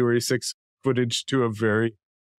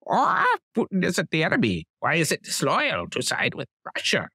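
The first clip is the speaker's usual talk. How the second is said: higher, lightly put-on pundit voice; Tucker as a parrot; 5 semitones higher than usual. Host reading the cartoon parrot's lines in a higher put-on voice. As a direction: play the cartoon parrot, a touch squawky and higher. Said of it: "He says".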